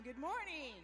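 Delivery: the crowd's redo of the greeting; another morning greeting. Shown as a demonstration. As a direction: greet them again, up and lively. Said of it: "let's try good morning one more time".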